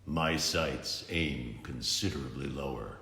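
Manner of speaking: deep, quiet brute voice; a villain's low rumble; hulking villain speaking low and soft; Bane's low menace. Acted. Bane voice, low.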